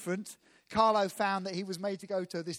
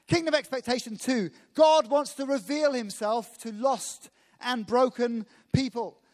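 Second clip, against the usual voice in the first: loud, higher, rousing sermon delivery; preaching at full force; 3 semitones higher, 4 dB louder than usual. Acted. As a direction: proclaim it out to the whole room. Preacher raising voice to congregation.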